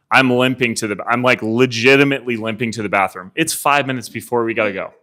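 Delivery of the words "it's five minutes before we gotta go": usual speaking voice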